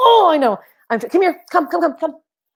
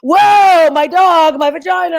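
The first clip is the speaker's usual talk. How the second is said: shouted whoa, much higher and louder, fading down; loud high gushing outburst; excited blurting; 8 semitones higher, 7 dB louder, slower than usual. Retold or imitated. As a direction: burst out overexcited in the middle of a serious talk. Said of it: "I'm like".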